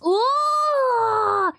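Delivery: high bright ooh; excited ooh. Real, not acted. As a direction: squeal with delight. Excited at game.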